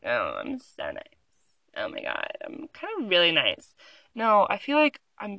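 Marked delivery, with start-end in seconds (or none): 0.1-3.8 lower, softer smug voice; lightly mock a self-satisfied nice person; mock fake-nice murmur.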